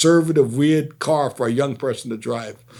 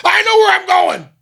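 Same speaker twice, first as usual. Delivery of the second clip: high, loud furious shout; angry shout; 8 dB louder than usual. Retold or imitated. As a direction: yell it back at him, offended. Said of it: "he started screaming at me".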